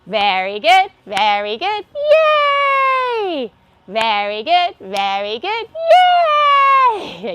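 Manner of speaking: loud, clapping-rhythm chant with a high yay; laughter yoga cheer. Shown as a demonstration. Laughter yoga leader leading a cheer, loud. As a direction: cheer yourself on. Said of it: "let's all say".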